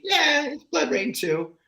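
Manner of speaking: high, slow, unimpressed; deadpan shrug. Retold or imitated. Viewer shrugging off the film, slow and unimpressed. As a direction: say it like nobody cares. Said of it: "It's gonna be".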